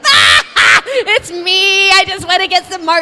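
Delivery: loud laughing shout of it's me; loud, laughing prank reveal. Retold or imitated. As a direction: burst out laughing as the prank drops.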